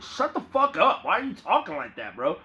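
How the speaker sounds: high-pitched mock outburst, settling down on the question; exasperated retort. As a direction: act out telling someone off, pitch jumping up at first. Acted retort to the would-be hypnotist.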